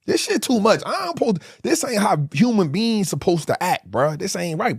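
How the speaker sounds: high, strained anguish; desperate plea. Retold or imitated. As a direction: cry out that it's too much. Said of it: "you just be like".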